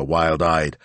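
plain reading voice

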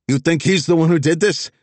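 panicked plea. Acted. Vess panicking at gunpoint.

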